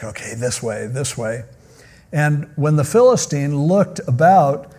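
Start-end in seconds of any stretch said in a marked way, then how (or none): 0.0-1.4 low, soft steering voice; quiet guiding. Retold or imitated. Shield bearer guiding Goliath, softly. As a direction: murmur directions to a half-blind giant.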